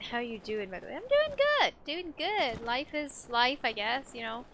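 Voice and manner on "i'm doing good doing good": high, bright sing-song reply; perky mock-cheerful answer; chirpy. Real, not acted.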